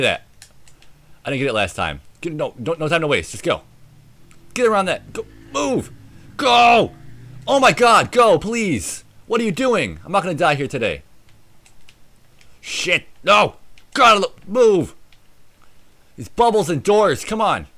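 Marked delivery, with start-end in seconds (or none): 4.5-14.9 frantic. Real, not acted. Frantic escape.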